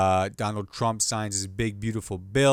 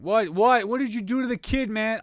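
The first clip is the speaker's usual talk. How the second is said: accusing adult; 10 semitones higher, 6 dB louder than usual. Acted.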